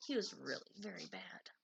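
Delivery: whispered sadly. A narrator's reading. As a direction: drop to an unvoiced whisper. Sad line in story.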